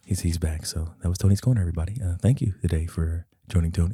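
low mock host voice; deep announcer voice. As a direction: play a deep show host. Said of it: "that voice is hilarious".

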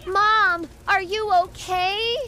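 high, alarmed calling out; panicked call. Acted. Daughter trapped in a fire calls to her mother, panicked. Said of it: "I panicked and called out to my mom".

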